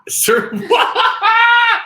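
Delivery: high, loud laughing shout of disbelief; real shriek of amazement, breaking into laughter; stunned surprise. Real, not acted. Host seeing [name] appear, astonished shout.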